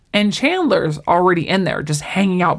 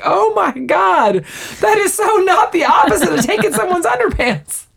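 mock outrage; 11 semitones higher than usual. Retelling a friend's protest over underpants, mock outraged. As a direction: act out an appalled sitcom complaint.

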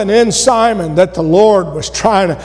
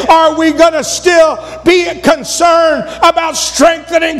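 higher, fervent preacher's cry; full-force preaching; 6 semitones higher than usual. Acted. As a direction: preach it out to the whole church.

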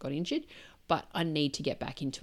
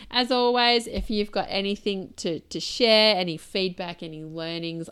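much louder, bright invitation to write in; eager sign-off; 8 dB louder than usual. Real, not acted. Host inviting podcast listeners to send feedback, loud and eager. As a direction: call out to listeners with energy.

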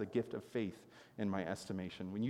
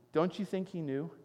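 ordinary talk

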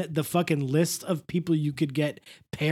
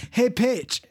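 Mister Oleander; 6 semitones higher than usual. Pretend. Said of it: "as Mister Oleander".

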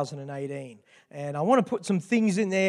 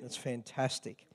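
usual speaking voice

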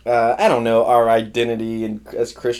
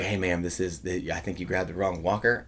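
gentle quiet correction; 5 semitones lower, 11 dB quieter than usual. Retold or imitated. Helper quietly tells the old lady she took the wrong walker. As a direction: lower and much softer, kindly.

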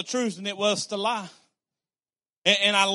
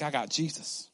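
calm answer; 4 semitones lower, 7 dB quieter than usual. Pretend. Calm answer after excited questions.

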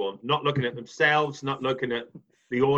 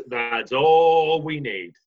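slow; much slower than usual. Real, not acted. Slow repeat of the phrase.

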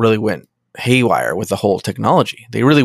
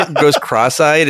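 normal talking voice

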